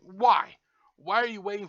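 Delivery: loud exasperated why. Real, not acted. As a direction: bark the question out in disbelief. Retorting at the quoted fan.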